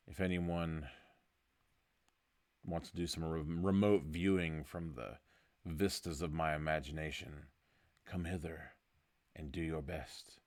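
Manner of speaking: soft, measured hypnotist's delivery; hushed mystic invitation. Acted. Host inviting listeners to remote-view his imagination, hushed mystic. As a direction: draw them quietly into your mind, with long pauses.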